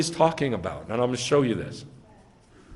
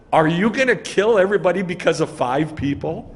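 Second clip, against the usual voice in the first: louder, higher, pressing the point; incredulous pleading challenge; pushy challenge; 5 semitones higher, 5 dB louder than usual. Shown as a demonstration. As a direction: demand it, don't ask politely. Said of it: "That's how he says it".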